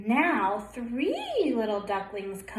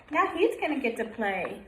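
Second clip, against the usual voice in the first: ordinary delivery, nothing marked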